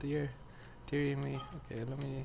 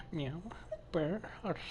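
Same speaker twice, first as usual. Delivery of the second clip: cat noises; 4 semitones higher, 8 dB quieter than usual. Acted. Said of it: "I might actually meow and purr".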